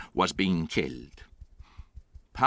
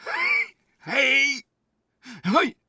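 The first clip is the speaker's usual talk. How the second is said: wild shouts, far higher and louder; shouting; 4 dB louder, much slower than usual. Acted. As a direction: scream it out again and again. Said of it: "stop shouting".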